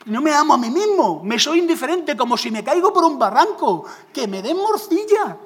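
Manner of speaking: loud, high, shrugging "so what"; indifferent retort. Pretend. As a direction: toss it off loudly, not caring at all. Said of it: "yo diría". (respuesta indiferente, alta y desenfadada)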